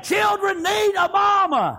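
high, slow preacher's shout; impassioned revival cry; fervent preaching. Real, not acted. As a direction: drive it home, drawn out and high. Preaches at full force.

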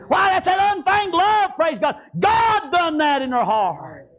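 shouted praise; preaching shout. Acted. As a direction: belt it out to the congregation.

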